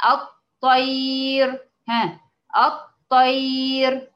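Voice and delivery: الطير drawn out very slowly with a thin ra; recited example word. Shown as a demonstration. Teacher slowly reciting الطير for students. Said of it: "تعالوا معايا يلا كلمة".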